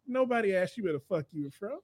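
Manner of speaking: ordinary talk, nothing marked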